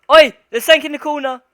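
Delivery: high loud startled cry; startled shout. Real, not acted. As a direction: jump at something in the corner. Spots something in corner.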